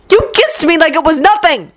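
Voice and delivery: high angry snap; snapped. Acted. Yuri snaps at Victor, finally undone. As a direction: snap it at him, coming undone. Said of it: "Yuri snapped".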